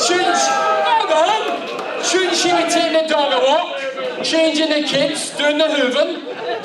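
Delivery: high, slow, sing-song mockery of a woman's place; high, slow mock-sexist sing-song; mocking chauvinist tone. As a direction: ham up the old-fashioned jibe, drawn out and high. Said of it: "So I says it like that".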